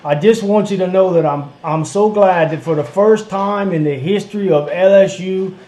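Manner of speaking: loud, hammed-up Cajun caller; earnest Louisiana caller in a put-on Cajun accent; bayou accent. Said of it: "where they talk like this".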